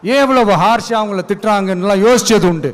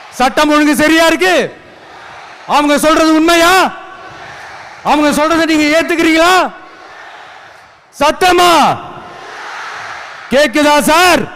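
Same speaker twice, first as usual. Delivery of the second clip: raised, high-pitched rally questions; calling to crowd; 4 semitones higher than usual. Real, not acted. (கூட்டத்தை நோக்கி உரத்த குரலில் கேள்வி)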